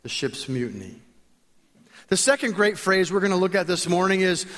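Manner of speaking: ordinary talk, nothing marked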